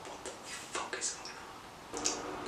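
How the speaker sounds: slow, hushed disbelief; alarmed whisper. Retold or imitated. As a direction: whisper it, startled by screams in the night. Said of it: "So I'm like".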